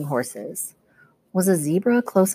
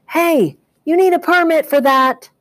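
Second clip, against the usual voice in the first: much higher, louder police shout; 11 semitones higher, 7 dB louder than usual. A narrator's reading. Policemen shout after the chasing men, loud and high. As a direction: holler after the runners.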